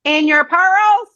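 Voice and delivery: stunned, stretched-out question; incredulous. Retold or imitated. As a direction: drag out pearls in mock shock. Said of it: "And I went".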